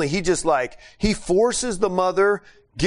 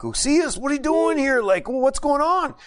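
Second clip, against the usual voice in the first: surprised and worried; 10 semitones higher than usual. Retold or imitated. Missionary opening the door to a distressed Ucias, higher alarmed surprise. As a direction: open the door to bad news.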